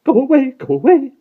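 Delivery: high, loud, panicked plea; frantic high-pitched cry; frightened. A narrator's reading. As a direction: drive it away in frantic fear. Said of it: "he said in a frightened whisper".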